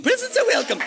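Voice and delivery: Presents are welcome, sung up high and coy. Shown as a demonstration. Host hinting to the audience for birthday gifts, high and coy. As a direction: chirp the hint for gifts in a high voice. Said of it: "repeat in a high voice".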